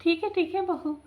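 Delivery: put-on high-pitched, placating old man; imitating a reluctant father-in-law; grudging agreement. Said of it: "मजबूरी से कहा".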